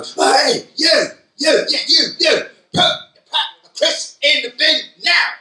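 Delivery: high, loud bark at a pupil; shouting teacher. Shown as a demonstration. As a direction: yell across the room like a cross teacher. Teacher yelling at pupil, acted.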